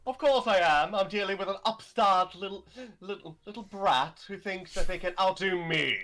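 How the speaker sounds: haughty, threatening diva; campy drag queen. Acted. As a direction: spit it out like an offended star. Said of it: "you really are arrogant".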